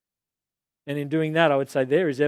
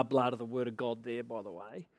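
ordinary talk

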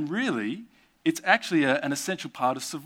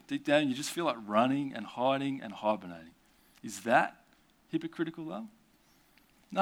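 ordinary talk, nothing marked

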